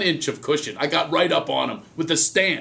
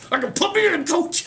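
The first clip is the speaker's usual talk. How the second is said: put-on very high pleading boy voice; high squeaky eager plea; eager kid; 13 semitones higher than usual. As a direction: beg the coach like a small boy. Eager kid begging the coach.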